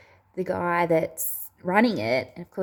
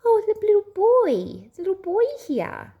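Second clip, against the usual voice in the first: put-on high, loud toddler voice; small child's high-pitched announcement; two-year-old daughter; 13 semitones higher, 5 dB louder than usual. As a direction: imitate an excited little child piping up. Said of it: "And she's like".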